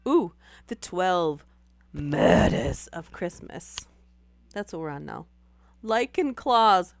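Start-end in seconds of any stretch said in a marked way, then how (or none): 0.1-3.8 spooky announcer. Pretend. 5.8-6.9 loud. Real, not acted. Reads funny misreading loudly.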